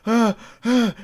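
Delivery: high, gasped mock screams; imitated panicked screams. Friend panicking on a trip, imitated gasping screams. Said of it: "he started screaming".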